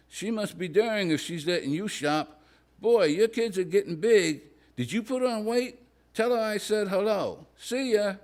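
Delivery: high, bubbly gossipy woman's voice; chatty woman friend. Acted. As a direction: gush like a nosy acquaintance, high up.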